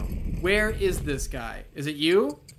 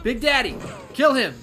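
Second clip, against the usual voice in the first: louder, higher shouted order; commanding shout; 7 semitones higher, 6 dB louder than usual. Real, not acted. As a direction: yell orders at the companion. Commanding Big Daddy in fight.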